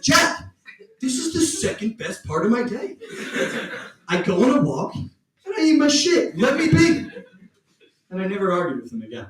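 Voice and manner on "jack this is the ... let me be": high, lightly voiced dog; the dog calmly defending his habit; voicing the dog. Dog answering his owner, high put-on voice.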